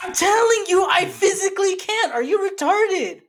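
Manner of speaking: high, loud, fed-up insistence; exasperated outburst. Real, not acted. Friend pressed to say it in Spanish, exasperated. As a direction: raise the pitch, arguing back in real frustration.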